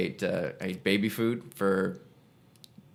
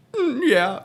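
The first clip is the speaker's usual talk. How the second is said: high, breaking voice as the tears come; bursting into tears; 5 dB louder than usual. Retold or imitated. Daughter answering her mom on the phone, breaking down in tears. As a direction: choke out the answer, about to cry. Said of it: "you bust out".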